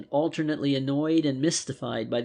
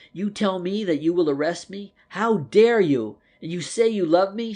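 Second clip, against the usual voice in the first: mild scorn, higher and louder; scornful; 6 semitones higher, 7 dB louder than usual. A narrator's reading. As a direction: hurl it back in disbelief, louder. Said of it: "she said scornfully".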